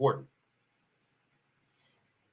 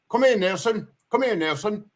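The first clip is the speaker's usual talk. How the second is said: put-on higher calling voice with a light Australian or New Zealand accent; calling the dog, hint of Aussie accent; 8 semitones higher than usual. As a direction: call the dog across the field with a touch of an Australian accent. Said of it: "I don't think it's an English accent".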